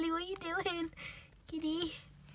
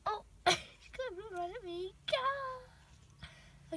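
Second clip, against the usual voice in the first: normal talking voice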